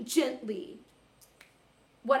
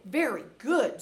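big swooping pitch on each word; retold teacherly praise, laid on thick; exaggerated praise; 5 dB louder, slower than usual. Teacher covering for cracked voice.

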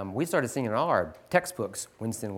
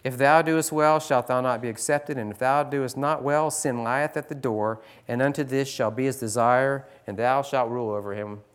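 scripture reading; 2 semitones higher, 5 dB louder than usual. Acted. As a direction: proclaim God's words to Cain. Reads King James scripture aloud.

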